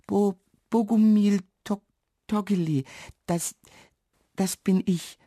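high-voiced nervous stutter; halting, broken words in a high voice; stammering. A narrator's reading. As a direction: stutter through a shy introduction. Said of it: "stottert er".

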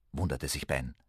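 plain reading voice

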